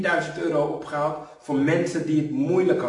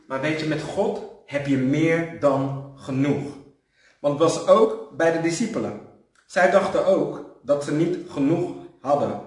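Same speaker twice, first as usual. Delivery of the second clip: normal talking voice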